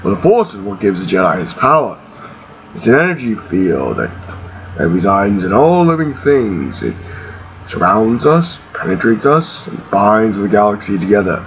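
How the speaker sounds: put-on low, measured old mentor; Ben Kenobi. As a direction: explain in a deep voice with thoughtful pauses. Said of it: "Ben Kenobi describes the Force".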